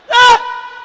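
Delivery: very high, very loud holler; sudden holler. Acted. Brother hollering for no reason, high piercing yell. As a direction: imitate a brother hollering out of nowhere. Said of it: "what the fuck he hollering for".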